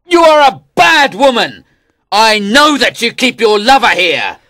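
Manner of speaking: high loud angry king's shout. Acted. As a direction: bellow it at the weeping queen. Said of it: "he shouted".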